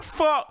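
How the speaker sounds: high, emphatic "Fo-" chopped halfway; cut off mid-word. Retold or imitated. Camera cuts away from the vendor, "Fo-" broken off. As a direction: start "Fo-" high and break it off. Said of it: "halfway through the word".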